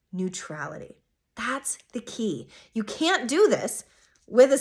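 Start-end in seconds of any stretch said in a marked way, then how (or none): 1.4-2.5 whisper. Real, not acted. Whispered aside on key point.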